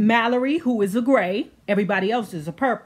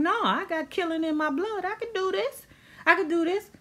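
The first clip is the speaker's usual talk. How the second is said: much higher, pumping herself up; mock psyched-up killer; 10 semitones higher than usual. Kathy Bates answering Sarah Paulson, lightly voiced eager resolve. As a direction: talk yourself into it, squeaky and eager. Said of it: "Kathy Bates was like".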